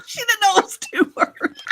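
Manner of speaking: high and loud, laughing through it; cracking up at the joke. Real, not acted. Co-host repeats the Yankee joke, laughing. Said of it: "That's a good one".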